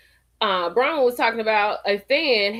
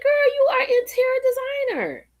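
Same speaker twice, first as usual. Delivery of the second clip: proud exclamation; faster than usual. Retold or imitated. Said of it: "I was like".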